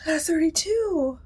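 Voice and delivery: gasped surprise. Real, not acted.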